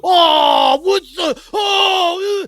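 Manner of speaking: loud, high groaning crowd; dismayed crowd groaning as Lux lands. Acted. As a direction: groan like a whole crowd at once. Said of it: "collective groan from the crowd".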